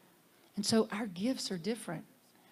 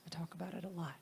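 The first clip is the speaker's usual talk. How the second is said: hushed aside; 3 semitones lower, 11 dB quieter, faster than usual. Real, not acted. Repeating her three principles.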